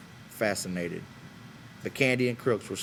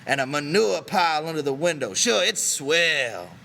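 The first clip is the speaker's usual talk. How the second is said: Crooks mocking a cosy room by a manure pile; bitter sarcasm; 6 semitones higher, 6 dB louder than usual. A narrator's reading.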